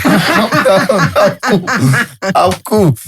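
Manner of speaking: high-pitched, laughing mock reassurance. Man retelling how he reassured his wary girlfriend, laughing and high-pitched. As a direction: protest it in a high voice through laughter.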